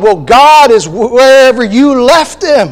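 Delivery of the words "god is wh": normal talking voice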